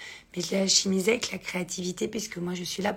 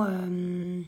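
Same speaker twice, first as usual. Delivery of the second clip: normal talking voice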